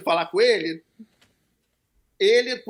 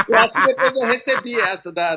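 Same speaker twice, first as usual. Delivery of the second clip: laughing; 6 dB louder than usual. Real, not acted. Laughing while recalling.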